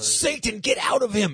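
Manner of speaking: joking priest casting out Satan; mock exorcist. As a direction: cast the demon out, overdone.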